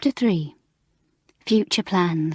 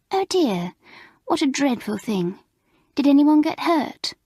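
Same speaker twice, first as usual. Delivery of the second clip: gushing schoolgirl; 7 semitones higher than usual. Acted. Gwen gushing sympathy.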